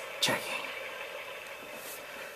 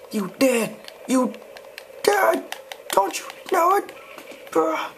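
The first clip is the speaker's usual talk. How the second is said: much higher mocking cartoon voice; squeaky taunting voice; 13 semitones higher than usual. Player mocking the dead enemy in a squeaky high voice.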